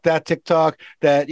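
usual speaking voice

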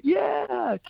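imitated crowd cheer, very high; high-pitched mock roar of assent; crowd screaming. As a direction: mimic a whole crowd yelling back. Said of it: "all the crowd's screaming".

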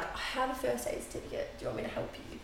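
very soft, near-whispered imitation; mock-earnest offer, under the breath; hushed joking offer. Partner joking around the patient with phone up, hushed.

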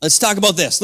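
usual speaking voice

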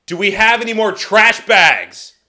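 loud, high mock yell; exasperated repeat, shouted for the hard of hearing; imitated angry-sounding holler. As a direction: imitate her bellowing from the next room. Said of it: "She'll be like".

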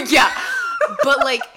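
loud burst of laughter; cracking up mid-sentence; laughing loud. Real, not acted. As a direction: laugh out the words.

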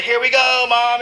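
gleeful downhill whoop; excited shout. Retold or imitated. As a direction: yell it as you jump on the chair. Riding the wheelchair downhill.

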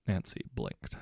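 usual speaking voice

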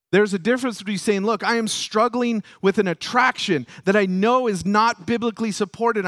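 loud, high, driving sermon delivery; impassioned preaching. Acted. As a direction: preach it with force and rising conviction. Preaches at full force.

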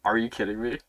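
lightly hammed-up disbelief; mock disbelief. Kid to dad after the game, mock incredulous. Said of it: "And I was like".

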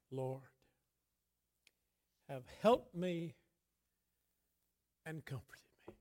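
hushed, almost voiceless reading; near whisper. Acted. Preacher reading David's thanks to God, whispered. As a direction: let the words fall to a whisper.

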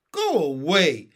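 loud sleepy grumble; grumpy protest. Acted. Benny to Henry, woken up on the road, a loud grumpy protest. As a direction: push them off, cross at being woken.

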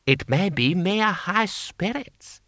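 high, slow, disdainful lord; haughty lord. Acted. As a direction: look down on them, slowly. Lord Hong mocking.

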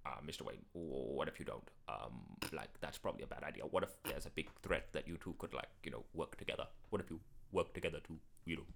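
low, very soft, hesitant butler; Alfred. Acted. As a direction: suggest it meekly to the master. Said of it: "all of Alfred and Batman's scenes".

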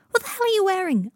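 high, loud, outraged question; appalled disbelief. A narrator's reading. Harry confronting Malfoy about his suit, appalled. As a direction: blurt it out, scandalised.

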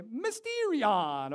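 slow, drawn-out Italian Mario voice; mock Mario accent. Shown as a demonstration. Pastor showing the congregation the word in a Mario voice. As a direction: stretch it out like Mario. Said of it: "Say it like Mario".

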